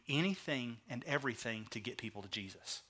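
very soft, low delivery; hushed emphasis. Real, not acted. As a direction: let the key point sink to a hush. Key point, dropped then repeated.

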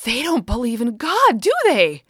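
put-on high, loud, astonished little girl; shocked child. A narrator's reading. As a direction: gasp it out in disbelief.

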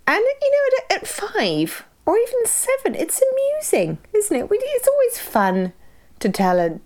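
very high sing-song voice; high mock voice. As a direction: pitch way up, mock amused. Mock amused adult voice.

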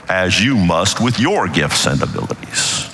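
usual speaking voice

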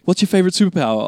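high, fast and eager; Freddy eagerly quizzing his new foster brother, quick and high; eager kid question. Retold or imitated. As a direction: fire the question off like an excited fan.

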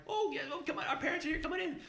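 put-on high, eager little-girl chatter; piping cartoon child voice; cartoon Irish girls. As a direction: imitate children welcoming guests in. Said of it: "cartoon versions of the Irish".

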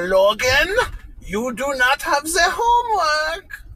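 high, slow, shouting scold with a German edge; mock German teacher scolding. Mom imitating the German teacher scolding Logan. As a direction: bark it at the kid like a harsh teacher. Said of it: "She's like".

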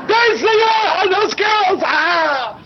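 very high, hammed-up squeal of excitement; mock high-pitched fangirl shout; imitated excited fan. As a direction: mimic an overexcited fan shouting. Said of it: "she's, like, masturbating, going".

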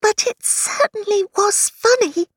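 Alice voice. A narrator's reading. Alice's line, high voice.

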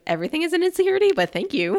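high, quick self-deprecating voice; high, fast quip. Retold or imitated. Jessica's anxious reply.

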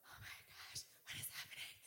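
whispered, shell-shocked panic; panicked at work. Retold or imitated. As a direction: whisper to yourself in dazed dread. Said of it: "I'm at work like this".

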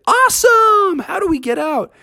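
very high excited squeak; bursting with glee; thrilled. Acted. Friends thrilled at winning, very high and excited.

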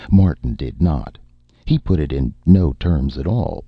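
ordinary delivery, nothing marked

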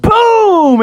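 high, loud booming impact; explosive sound-effect word; crash exclamation. Acted. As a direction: shout the crash of a wave. The wave slams down.

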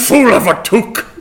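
mock film scolding. Film quote impression.

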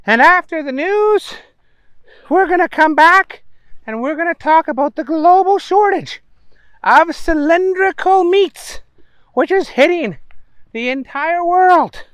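high bright newscaster voice; news reader. A narrator's reading. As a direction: read it like a TV anchor. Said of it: "the T V woman saying".

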